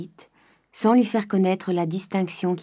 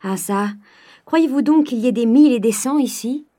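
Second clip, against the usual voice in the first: louder, higher miserly scolding; irritated outburst; 5 semitones higher, 7 dB louder than usual. Acted. Miser father scolding wife and daughter loudly. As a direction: snap at the spendthrift talk. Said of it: "s'écria le père".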